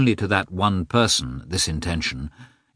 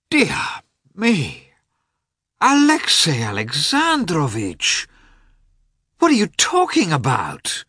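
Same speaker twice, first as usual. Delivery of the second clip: high, drawn-out exclamation of dismay; dismayed cry; 8 semitones higher, slower than usual. A narrator's reading. Oblonsky hearing of his sister's divorce, dismayed cry. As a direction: cry out high, pained and disbelieving. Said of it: "He uttered an exclamation of dismay".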